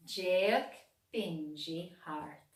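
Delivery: usual speaking voice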